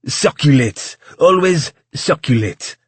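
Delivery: loud, over-the-top French maitre d'; thick French accent. A narrator's reading. Maitre d' instructing new waiters, thick French accent. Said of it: "in a thick French accent that sounded almost too French".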